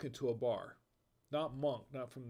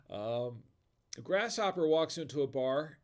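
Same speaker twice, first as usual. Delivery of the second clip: ordinary delivery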